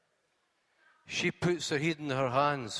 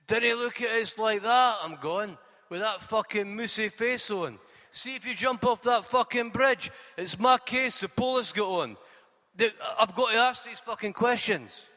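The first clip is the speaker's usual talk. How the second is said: higher and louder, irritated Scottish cabbie; defensive cabbie justifying himself, pitched up; 7 semitones higher, 4 dB louder than usual. Acted. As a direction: cabbie telling off his fare, raised and higher. Cabbie snaps at passenger.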